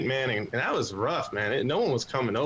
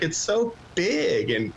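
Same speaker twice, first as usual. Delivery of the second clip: ex saying pink; 5 semitones higher, much slower than usual. Retold or imitated. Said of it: "She's like".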